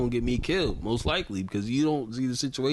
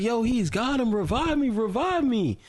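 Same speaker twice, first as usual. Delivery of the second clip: panicked teammate; 10 semitones higher than usual. Retold or imitated. Downed teammate begging for revive.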